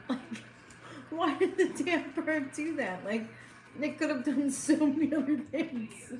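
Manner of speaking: trying not to laugh at a sad story. Real, not acted. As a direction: giggle through the telling. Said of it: "You're laughing".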